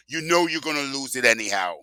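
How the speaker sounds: normal talking voice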